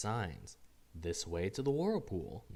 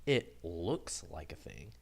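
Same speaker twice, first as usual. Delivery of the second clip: quoting the new creature's pretext; 4 semitones lower, slower than usual. Retold or imitated. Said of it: "always that same pretext is offered".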